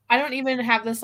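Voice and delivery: usual speaking voice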